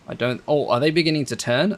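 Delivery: usual speaking voice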